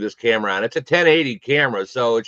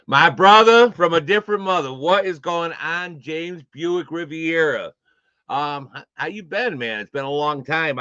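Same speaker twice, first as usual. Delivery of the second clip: excited greeting; 5 semitones higher than usual. Real, not acted. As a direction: greet him like a long-lost brother. Greeting an old friend on stream.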